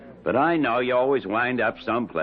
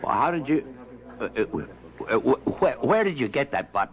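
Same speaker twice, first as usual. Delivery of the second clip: flustered stammer; 3 semitones higher than usual. Acted. Abner caught, panicked stammer.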